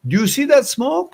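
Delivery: high retold shout to a crowd, fast at first; calling out to the line. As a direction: yell out to everyone queuing for bread. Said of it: "You start yelling".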